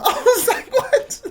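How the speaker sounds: a very high squeak of disbelief through laughter; can't hold the laugh in; laughing what. Real, not acted. Laughing at his brother's complaint, a squeaky cracking-up what.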